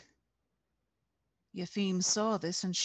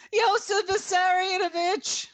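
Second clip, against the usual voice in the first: high, loud cry of the name; voice ringing out; 13 semitones higher, 9 dB louder than usual. A narrator's reading. Yelena cries out to Stalin while arrested, ringing cry. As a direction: call out to Stalin at full voice. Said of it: "Yelena's voice rang out".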